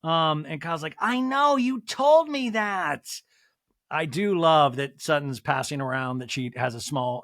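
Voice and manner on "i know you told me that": high, drawn-out mock exasperation; exasperated Kyle. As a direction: imitate an exasperated friend, stretching the last words. Acts out Kyle's exasperated retort.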